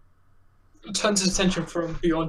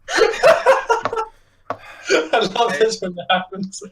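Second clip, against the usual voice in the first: laughing; 6 semitones higher, 3 dB louder, much slower than usual. Real, not acted.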